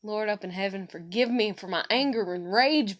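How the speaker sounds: ordinary delivery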